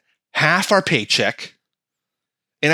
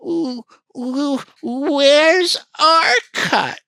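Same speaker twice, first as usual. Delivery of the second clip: greedy California; 11 semitones higher than usual. Retold or imitated. California state whining to the taxpayer for its cut, high and wheedling. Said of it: "California came in and went".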